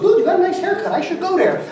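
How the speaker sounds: Mike's voice, pitched well up and impressed; high buddy voice. Retold or imitated. Buddy Mike admiring haircut.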